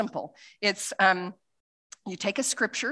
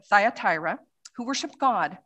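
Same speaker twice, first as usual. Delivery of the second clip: ordinary talk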